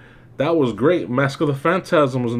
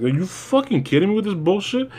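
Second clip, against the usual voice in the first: higher, incredulous rant; exasperated outburst; 8 semitones higher than usual. Retold or imitated. Reviewer venting at the silly transformation, higher and incredulous. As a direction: throw up your hands in disbelief.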